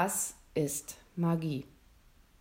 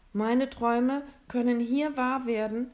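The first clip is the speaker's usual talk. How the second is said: put-on higher, surprised young boy; lightly voiced boy; 5 semitones higher, 5 dB louder than usual. William recognizing his own wish list, higher and surprised. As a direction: pitch it up like a startled child.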